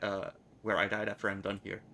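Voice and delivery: ordinary talk, nothing marked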